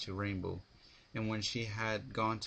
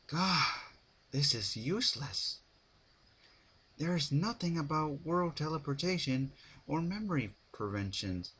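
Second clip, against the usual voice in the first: higher, exasperated mare voice; frustrated Twilight; 7 semitones higher than usual. A narrator's reading. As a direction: sigh out the frustration, pitched up.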